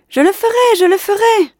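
high, loud excited promise; boy eagerly calling down his promise; eager shout. Acted. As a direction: shout it back, thrilled. Boy answering from window.

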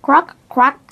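two high, loud croaks; friendly frog croak. A narrator's reading. Friendly green frog greeting all, croaking. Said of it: "said the friendly green frog".

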